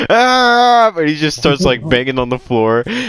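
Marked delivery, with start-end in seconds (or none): 0.1-0.9 long high yell; hammed-up drawn-out scream; mock outburst. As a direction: wail it out loud and high. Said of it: "he just goes".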